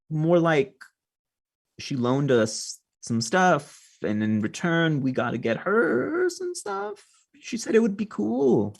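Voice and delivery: put-on squeaky, slow, guilty kid; a nervous kid voice up high, dragging. The kids voiced by the GM.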